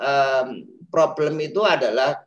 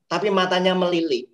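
ordinary talk, nothing marked

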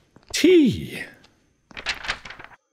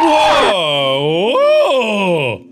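startled; 5 semitones higher, 5 dB louder than usual. Real, not acted. Jump scare in game.

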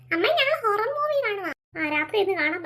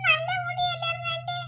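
crying; 9 semitones higher than usual. Acted. Shaved-headed girl dreading school teasing, crying. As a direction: cry miserably, stretching the words. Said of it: "ഇങ്ങനെ ഇരുന്ന് കരഞ്ഞിട്ടെന്തോ".

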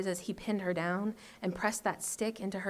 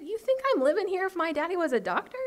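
high-pitched disbelief; acted incredulous comeback; incredulous scoff; 9 semitones higher than usual. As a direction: scoff as if the question were ridiculous. Boy scoffs at girl's question.

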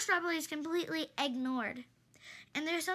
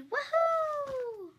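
gleeful whoop; 9 semitones higher, 4 dB louder than usual. Acted. Characters sliding with joy.

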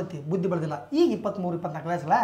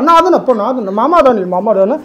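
noisy mock-tender reassurance; feigned coaxing; 11 dB louder than usual. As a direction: overdo the comforting at full volume. Fake comforting of beaten nephew.

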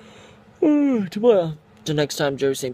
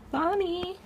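very high squeaky pet call; calling the pet in a tiny high voice. Real, not acted. Calls pet name squeakily.

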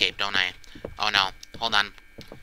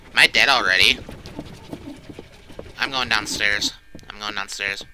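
ordinary delivery

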